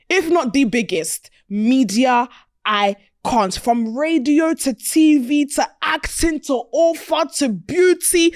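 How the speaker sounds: emphatic show-host introduction; hype guest introduction. Acted. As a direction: build the hype up to the guest's name.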